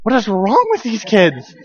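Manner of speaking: slow, bewildered mock aside; puzzled disbelief at the kids; exasperated. Dad baffled by the kids' panic, slow mock exasperation. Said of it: "And I was like".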